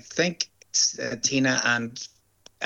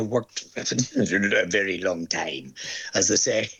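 laughing; slower than usual. Real, not acted. Chuckles while speaking of long career.

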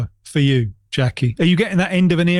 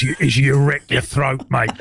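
Ange Postecoglou; 4 semitones lower than usual. Retold or imitated. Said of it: "trouble with doing Ange Postecoglou".

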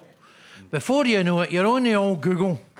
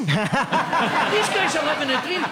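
slow, higher and laughing through it; laughing sarcasm; 6 semitones higher, slower than usual. Real, not acted. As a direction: drag it out with a laugh. Repeated, slower, laughing.

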